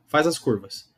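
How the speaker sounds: usual speaking voice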